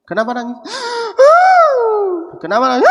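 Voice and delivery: hammed-up sobbing gasps with loud high cries of why are you crying; mock wailing. Preacher mocks a child crying at a parent's grave, hammed-up wailing. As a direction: wail it like a ridiculous crying child.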